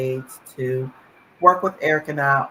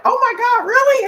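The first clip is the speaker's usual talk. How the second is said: high squealing excited gasp, much louder; thrilled disbelief; 8 dB louder than usual. Retold or imitated. Mother retelling the senator's promise, squealing with excitement. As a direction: shriek it like you can't believe the news. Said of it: "And Eric and I were like".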